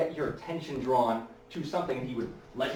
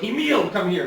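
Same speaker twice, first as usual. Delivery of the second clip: loud, higher call across the stage; 8 semitones higher, 6 dB louder than usual. Retold or imitated. Houdin summoning his son Emile on stage, loud showy call. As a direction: summon the boy with a showman's flourish.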